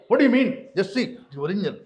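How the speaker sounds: mock angry retort. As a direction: snap back as the insulted man. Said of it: "So he got so mad".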